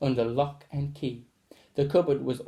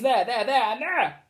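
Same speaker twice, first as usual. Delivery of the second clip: eager pointing; 8 semitones higher than usual. Acted. Rebel points out signs to teacher.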